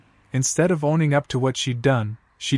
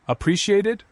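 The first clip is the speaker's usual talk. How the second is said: echoed word pitched up high in disbelief; voice rising; 8 semitones higher than usual. A narrator's reading. Husband repeats wife's excuse back at her, rising pitch. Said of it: "my voice rising".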